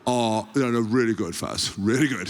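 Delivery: low, dopey people-pleasing voice; Chris Robshaw. Retold or imitated. Captain assuring the coach, low and meek. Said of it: "it's how he sounds, not me doing anything".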